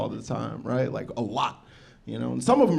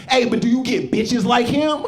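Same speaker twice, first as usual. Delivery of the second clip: heckler lady; 9 semitones higher, 4 dB louder than usual. Retold or imitated. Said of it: "one lady was just like".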